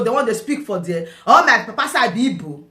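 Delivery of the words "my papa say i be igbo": ordinary talk, nothing marked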